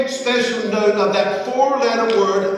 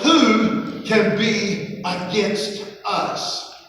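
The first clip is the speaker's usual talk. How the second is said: slow, deliberate reading, "who" drawn out; slow emphasis; much slower than usual. Shown as a demonstration. Preacher to congregation, slow and weighty. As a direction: lay each word down slowly. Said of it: "preacher slows key verse".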